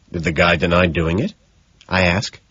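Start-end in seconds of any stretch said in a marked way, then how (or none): none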